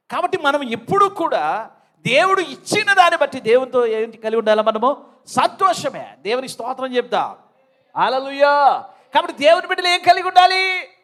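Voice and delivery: loud, high preaching to the congregation; full-force preaching. Real, not acted. Preacher asking the congregation what God's children must have, full-force preaching. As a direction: preach it out loud, pitch lifted.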